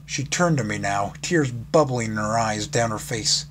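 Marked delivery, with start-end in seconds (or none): none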